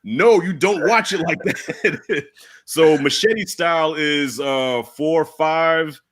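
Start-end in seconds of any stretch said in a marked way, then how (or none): 0.0-2.2 slow, laughing mock protest; mock outraged friend. Friend rejects Machete order, slow laughing mock outrage. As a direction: scold the wrong viewing order while laughing. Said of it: "I could feel the heat through Messenger".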